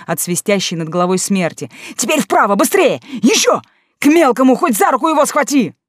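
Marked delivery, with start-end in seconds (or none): 2.0-5.7 high loud urgent yells; frantic shouting. A narrator's reading. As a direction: shout each command in panic. Mik yelling directions in fight.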